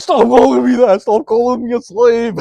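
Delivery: high, loud, near-tearful shouting; whiny yell. Retold or imitated. Coworker yelling at the narrator to stop calling him a slave, high whiny shout. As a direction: imitate him yelling like he's about to cry. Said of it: "it sounded like he was".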